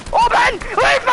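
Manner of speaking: high, loud frantic yells; panicked shouting. Real, not acted. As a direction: scream directions in panic. Panicked calls in fight.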